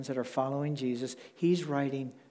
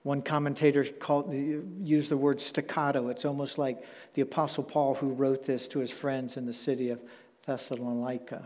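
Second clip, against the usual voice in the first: usual speaking voice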